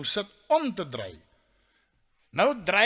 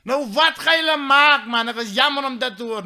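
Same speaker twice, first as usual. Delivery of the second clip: calling out; 6 semitones higher, 8 dB louder than usual. Acted. Friend below asks what they will do, calling out. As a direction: shout the sympathy up to him. Shouted exchange across stadium.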